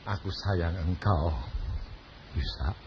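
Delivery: low, soft loving words; hushed, deep divine tenderness; tender voice of God. Retold or imitated. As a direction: reassure him like a loving father. God comforts him.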